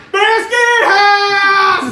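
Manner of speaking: drawn-out Biscuit House! in a high, loud, slow hillbilly shout; hillbilly yell. Retold or imitated. Yelling Biscuit House at truckers in a hillbilly holler. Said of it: "with that hillbilly inflection".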